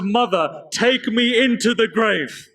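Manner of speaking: high-pitched cry out to a mother; anguished plea. Acted. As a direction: plead from the heart, pitch lifted high. Acted plea at mother's grave.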